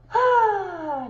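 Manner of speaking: long, high, loud "aah"; loud exclaimed "ah". Real, not acted. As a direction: let out a big, falling aah. Loud exclamation before the message.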